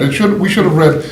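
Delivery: usual speaking voice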